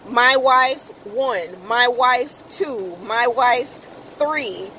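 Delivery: slow, spaced-out my wife one, two, three; deliberate listing. Retold or imitated. Reading the contact list to the audience, slow and deliberate. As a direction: lay each name out slowly for effect.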